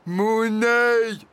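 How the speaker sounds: high, loud disbelieving cry; scoffing exclamation. A narrator's reading. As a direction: cry out that you don't buy it. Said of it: "s'écria Flamèche". (exclamation incrédule de dragon)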